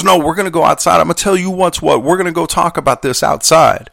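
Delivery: high-pitched, forceful mock challenge; confrontational coworker. Angry carrier orders colleague outside to settle it, acted confrontational. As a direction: imitate a hothead calling someone out to fight. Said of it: "it's clear from his tone".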